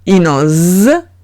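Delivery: y no z said slowly, buzzing the z; s not z. Shown as a demonstration. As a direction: contrast s with a voiced z. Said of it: "para poder decir s".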